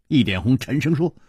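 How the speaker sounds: ordinary delivery, nothing marked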